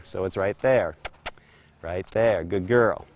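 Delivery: low, soft guiding words; low murmur to the horse; soft cue. Real, not acted. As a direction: gently mark the moment. Cueing then praising horse.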